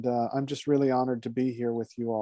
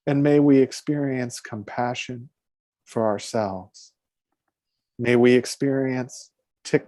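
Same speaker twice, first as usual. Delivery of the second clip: guided meditation; 2 semitones higher than usual. Acted. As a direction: lead the congregation into calm breathing, unhurried.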